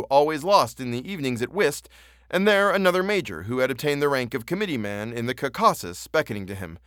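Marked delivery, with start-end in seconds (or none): none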